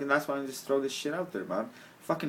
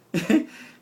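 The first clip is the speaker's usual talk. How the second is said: higher, louder rising laugh; a quick burst of giggling; real laugh; short laugh; 9 semitones higher, 6 dB louder than usual. Real, not acted. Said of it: "he's cracking up".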